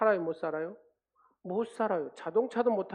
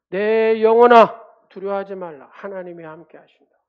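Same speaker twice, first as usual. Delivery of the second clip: proclaiming to one's soul; 3 semitones higher, 15 dB louder than usual. Shown as a demonstration. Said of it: "이렇게 계속해서 선포하고 읊조리니까".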